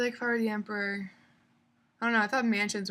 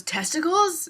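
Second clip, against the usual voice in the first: vendor offer; 9 semitones higher, 7 dB louder, slower than usual. Retold or imitated.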